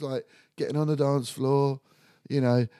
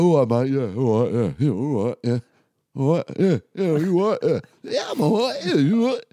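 louder, matey laddish chorus of all rights; lads greeting each other; 5 dB louder than usual. Retold or imitated. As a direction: bounce through the greetings like a pack of blokes. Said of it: "Such a shame people can't see you doing that".

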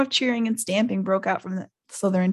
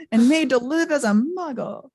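sobbing Hagrid; 7 semitones higher, 6 dB louder than usual. Acted.

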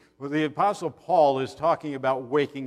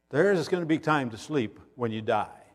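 ordinary delivery

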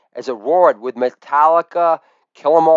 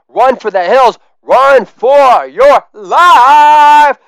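building from raised to high, loud, slow wail; screaming metal singer; 7 semitones higher, 6 dB louder, much slower than usual. Acted. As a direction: start loud and stretch into a wail. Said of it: "a voice emerged from the fire that screamed".

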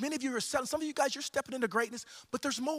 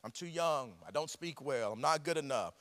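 self-doubting excuses; 7 semitones lower than usual. Acted. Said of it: "Many of you are like Jeremiah".

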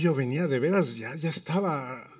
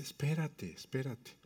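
very soft, placating; imitated wife's hushed patience; retold hushing; 13 dB quieter than usual. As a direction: quietly hold him back. Wife telling him to wait, retold.

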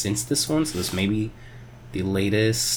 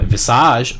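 normal talking voice

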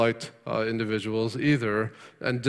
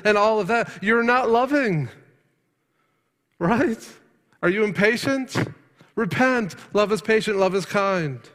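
fiery preaching; 8 semitones higher than usual. Acted. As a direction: urge the congregation hard. Preacher at full force.

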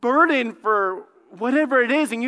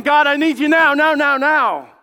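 acted urgent shout, higher and much louder; desperate plea; 6 semitones higher, 8 dB louder than usual. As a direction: cry out to God in mock panic, stretching the repeats. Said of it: "it is an act of desperation".

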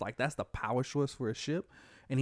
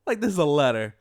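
incredulous outburst; 3 semitones higher, 6 dB louder than usual. Acted. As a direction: squeal the first word, then blurt the rest loudly. Teller's own incredulous reaction, acted out.

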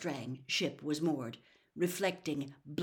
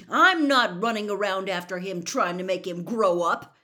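much louder, exasperated outburst; 2 semitones higher, 11 dB louder than usual. A narrator's reading. Harry shouts about Ron, startling the owls. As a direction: let it ring across the lawn. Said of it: "so loudly that several owls".

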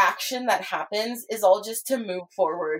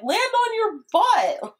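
high, stretched-out mock holler; quoted cartoon cry; slower than usual. SpongeBob flying off the mountain, high mock cry. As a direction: call it out high and drawn-out. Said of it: "just like".

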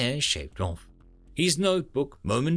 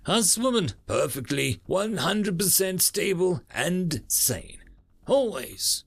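sarcastic; 6 semitones higher, slower than usual. Acted. Ironic self-praise.

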